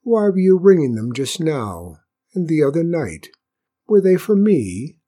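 eager question under feigned casualness; trying to sound casual. A narrator's reading. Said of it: "trying to sound casual".

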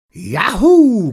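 loud, high cowboy yahoo; cried out. A narrator's reading. Janitor cheering the boy's wild ride, cried out. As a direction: whoop for the rider. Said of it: "cried Mister Leeks".

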